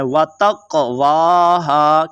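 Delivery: तकवाहा with a small bounce on the q; slow recited तकवाहा, echoing q; slight qalqala. Shown as a demonstration. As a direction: bounce the क in तकवाहा, then stretch "waahaa". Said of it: "थोड़ा सा कलकला करना है".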